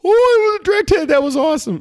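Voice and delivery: very high, loud delighted shout; thrilled whoop. Retold or imitated. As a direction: cheer the direct hit. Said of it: "I'm like".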